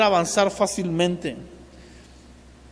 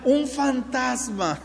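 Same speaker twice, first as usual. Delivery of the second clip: mock scared cry; 3 semitones higher, 5 dB quieter, slower than usual. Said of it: "empiezan a gritar".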